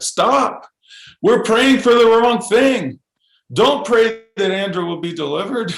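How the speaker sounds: mother interrupting a prayer meeting, high and urgent; imitating his mother.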